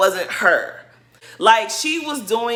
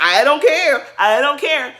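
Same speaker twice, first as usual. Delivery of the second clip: imitating a louder, higher defensive outburst; upset retort; 6 semitones higher, 5 dB louder than usual. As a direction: insist it in a mock tantrum, pitched up. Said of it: "she was getting so upset".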